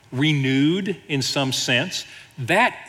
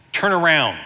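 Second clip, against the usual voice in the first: louder, higher testy GPS lady; mock-impatient order from a sat-nav; a little testy; 4 semitones higher, 5 dB louder than usual. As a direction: imitate a navigation lady losing patience. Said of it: "she gets a little testy".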